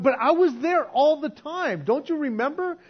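mock hurt protest; protesting believer. Rejected believer protesting to Jesus.

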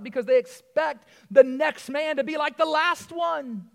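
fired-up preacher pleading with his church; full-force preaching. Acted. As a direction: drive it home from the pulpit.

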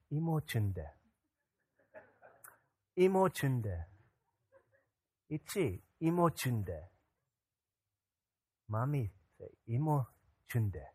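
lightly imitated child murmuring softly; soft repeated self-comfort, with pauses; child reassuring herself. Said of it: "she's sitting in her car seat".

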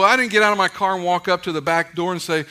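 normal talking voice

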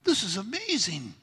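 amazed onlooker. Pretend. Congregation imagining Jesus speaking before them, amazed. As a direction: react like an awestruck listener. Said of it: "You'd be like".

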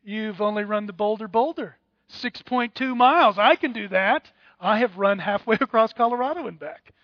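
loud mock laugh then boastful ribbing; hammed-up runner bragging; Mick Daly mocking. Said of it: "To which Mick Daly would go".

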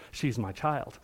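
ordinary talk, nothing marked

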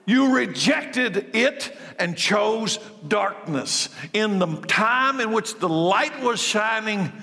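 high, ringing pulpit voice building with fervor; preaching at full force. Acted. Pastor voicing Jesus's lament to the congregation, fervent preaching. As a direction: preach it out over the congregation, impassioned.

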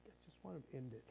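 soft, fast, near-whispered despair; hushed despair. Retold or imitated. Desperate soul quietly begging God, soft and fast. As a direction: barely voice it, worn out. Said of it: "it's like".

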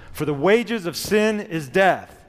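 loud, high, slow declaration; proclaim like angels. Shown as a demonstration. Congregation reciting verse together, loud and slow. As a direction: ring it out like a host of angels. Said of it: "like the host of angels, let's do it".

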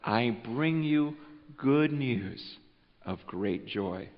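slow, soft, low announcement; hushed angel voice bearing joy; tender proclamation. Acted. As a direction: savor each word gently. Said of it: "he says".

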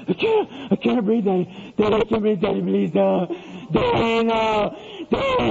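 imitated gasping, wailing child; hysterical crying. Son begs Daddy before spanking, mock hysterical crying. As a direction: sob and choke out the words, hammed up. Child wails that he cannot breathe.

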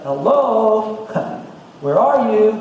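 high playful calling out; God calling out. Acted. God calling to the hiding Adam and Eve, playful. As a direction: call as if seeking hidden children. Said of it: "God's like".

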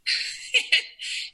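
airy high laugh; breathy laugh. Real, not acted. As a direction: laugh out without voice. Laughing at the joke.

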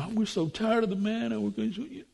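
lightly mocked moaning people; mock whine. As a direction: mimic the grumblers. Mocking complaining Israelites.

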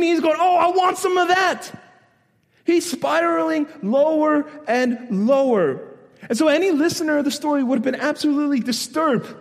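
high, loud, driving sermon delivery; full-force preaching. Real, not acted.

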